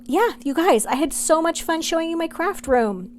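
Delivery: bright, high, gushing wrap-up; beaming with delight at the finished room; gushing makeover reveal. Real, not acted. Cheerful sign-off of room tour.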